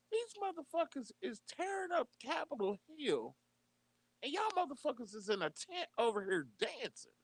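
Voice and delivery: can't believe what he's seeing; outraged disbelief. Retold or imitated. Disbelief at the tent party.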